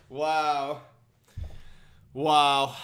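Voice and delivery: loud, flat-toned "Wow". Pretend. Player mocking his own inner whiteness with a loud wow. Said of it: "I just brought out my inner whiteness".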